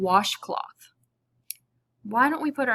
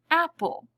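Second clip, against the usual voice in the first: Apple said high and loud, two clear beats; syllable demo; 8 semitones higher, 4 dB louder than usual. Shown as a demonstration. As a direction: sing out both beats of Apple. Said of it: "if we were to hum the word apple".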